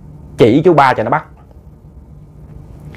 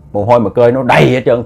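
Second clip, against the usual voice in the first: ordinary delivery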